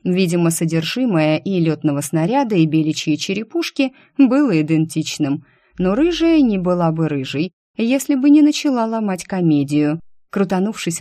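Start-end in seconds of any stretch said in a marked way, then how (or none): none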